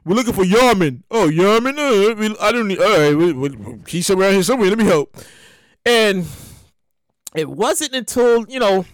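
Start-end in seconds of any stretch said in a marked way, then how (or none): none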